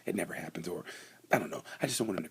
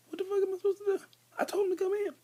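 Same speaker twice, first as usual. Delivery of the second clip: squeaky high mock outburst, louder; mock pit crew panic; 5 dB louder, faster than usual. Pit crew screaming at riders who won't come in, squeaky high mock panic. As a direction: squeal it like a flustered crew chief.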